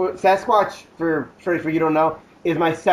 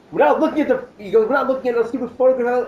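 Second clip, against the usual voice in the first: mock indignant, higher and quick; higher, irritated retort; Travis voiced higher; 7 semitones higher, faster than usual. Friend Travis refusing to look, mock indignant higher voice. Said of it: "he's just like".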